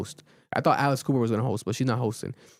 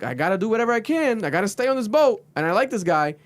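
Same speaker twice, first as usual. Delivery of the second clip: scheming; 7 semitones higher than usual. Retold or imitated. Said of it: "So she's like".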